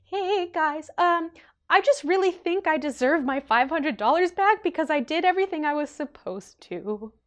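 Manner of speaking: high, sugary people-pleasing voice; overly polite, careful plea for money back; sweet and polite. Retold or imitated. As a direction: ask nicely, trying not to seem angry. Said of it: "It was more so like".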